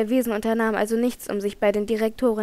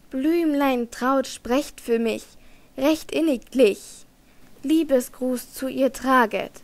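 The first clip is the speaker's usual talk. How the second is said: slow, drawn-out poetic recitation; recited aria verse; slower than usual. A narrator's reading. Stage song to the flowers in Margarete's garden, recited slowly. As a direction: linger on each short phrase of verse.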